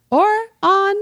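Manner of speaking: lightly put-on English accent, first word high; a quick hint of an English accent. Signing off the podcast in a light English accent. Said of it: "I knew you were gonna do that in an English accent".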